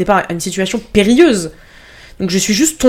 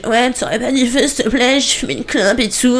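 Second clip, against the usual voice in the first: put-on drunk voice; 5 semitones higher, 3 dB quieter than usual. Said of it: "trop bourré".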